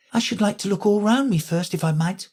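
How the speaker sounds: put-on high polite girl voice; Alice asking to look around. A narrator's reading.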